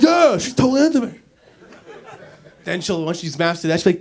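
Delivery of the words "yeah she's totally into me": higher, quick, pleased with himself; mock enthusiastic. Man thinks she likes him, mock enthusiastic. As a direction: gush like a smitten fool. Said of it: "That's how he sounds when he's enthusiastic".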